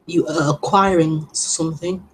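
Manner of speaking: ordinary delivery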